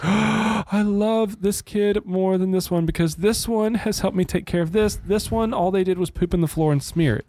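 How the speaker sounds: high-pitched hammed-up adoration; mock gushing parent. Parent secretly comparing their kids, hammed-up high-pitched gushing.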